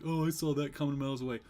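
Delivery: lightly mocking a smug know-it-all; smug mimicry. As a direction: imitate smug people, a touch self-satisfied. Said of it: "really smug people be like".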